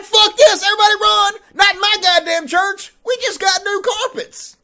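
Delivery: high, loud mock panic; panicked mock yell. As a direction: yell like a pastor fleeing the flood. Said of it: "He's like".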